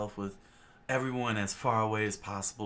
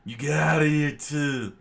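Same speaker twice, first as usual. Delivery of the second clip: loud, higher angry shout; 6 semitones higher, 11 dB louder than usual. Acted. Chef yelling a rowdy customer out, loud and angry. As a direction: throw him out of the restaurant at full volume.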